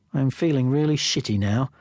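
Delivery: usual speaking voice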